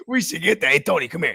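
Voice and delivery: ordinary talk, nothing marked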